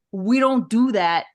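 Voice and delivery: put-on New York accent. Fan scolding her in the stands, light New York accent. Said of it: "pretty good New York accent you got going on there".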